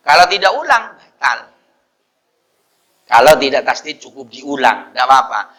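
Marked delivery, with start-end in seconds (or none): none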